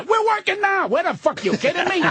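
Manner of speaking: mock disbelief, voice jumping way up; exasperated retort. Retold exasperated reply to [name].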